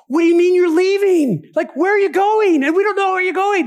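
put-on high-pitched, upset whine of a left-behind child; childlike protest. Thomas hears that Jesus is leaving, childlike dismay. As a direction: protest in acted childlike bewilderment. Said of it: "it's almost childlike for, for Thomas".

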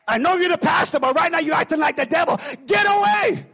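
high, loud commanding shout; rebuking shout. Retold or imitated. Youth pastor rebuking the pastor over the dead woman, loud commanding shout. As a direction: command the pastor back like Satan. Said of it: "So he goes".

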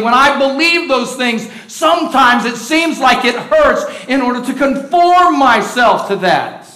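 fervent pulpit delivery; preaching. Acted. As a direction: preach it with weight. Preacher building to full force.

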